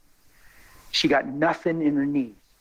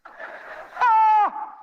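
excited scream. Real, not acted. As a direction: let out a high yell of glee. Exclaims over his sudden congregation.

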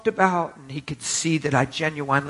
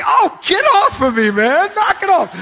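high, loud playful protest; mock annoyed shout; 7 dB louder than usual. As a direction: holler as if fighting off a surprise hug. Acts out friend hugged from behind.

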